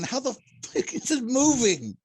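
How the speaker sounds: very high, loud disbelief; incredulous outburst. Real, not acted. Player questions how a thumb-up hand crawls, high incredulous cry. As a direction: blurt it out high and loud, utterly baffled.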